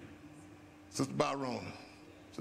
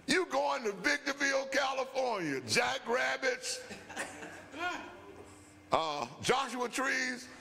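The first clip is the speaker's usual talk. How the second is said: mock announcement; 7 semitones higher than usual. Boss announcing posting.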